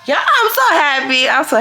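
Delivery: high, gliding down as it repeats; happy gushing. Real, not acted. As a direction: gush it high, then settle. Repeating her joy.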